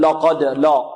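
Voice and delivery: mistaken heavy, dark ل in لقد; تفخيم اللام خطأً. Shown as a demonstration. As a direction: darken the ل of لقد to match its ق. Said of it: "ولا نقول". (تفخيم لام لقد خطأً)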